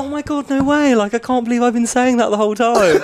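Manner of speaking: high mock-horrified protest through laughter; hammed-up disbelief, laughing; feigned shock. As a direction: play innocent and aghast, pitched right up. Acting feigned shock in anecdote.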